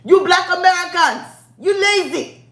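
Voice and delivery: high, loud mock Nigerian accent; Pookie. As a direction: scold in an imitated Nigerian accent. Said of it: "That's what the Nigerian podcast Pookie said".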